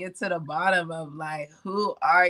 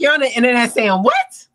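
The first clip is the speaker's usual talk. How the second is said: loud, higher mock disbelief; outraged parent; 6 semitones higher, 10 dB louder than usual. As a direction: imitate a parent shouting in disbelief. Mimics outraged parents reacting.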